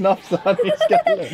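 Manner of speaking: high, laughing words; real giggles through the sentence. Real, not acted. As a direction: break up laughing while pointing it out. Laughs at pig nibbling foot.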